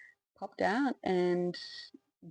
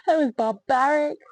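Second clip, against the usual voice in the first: indignant; 8 semitones higher, 6 dB louder than usual. Real, not acted. Outraged judgement of birth.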